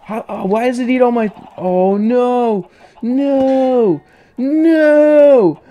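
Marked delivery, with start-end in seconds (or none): 1.6-5.6 1 panicked no. Real, not acted. Creature eating all her carrots.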